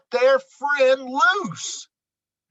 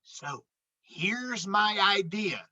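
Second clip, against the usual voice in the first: a softer secret voice; conspiratorial hush; 6 dB quieter than usual. Acted. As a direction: lean in and share the plan quietly. Fox plots in secret.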